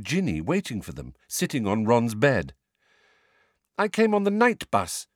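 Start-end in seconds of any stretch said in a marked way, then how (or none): 3.8-5.1 light, high and breezy; a touch of airy nonchalance. A narrator's reading. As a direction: toss it off airily, no big deal. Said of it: "said Hermione airily".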